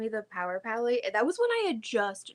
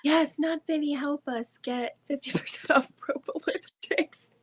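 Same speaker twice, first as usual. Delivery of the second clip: whisper; 6 semitones higher than usual. Pretend. Joking plea to Not Vinny.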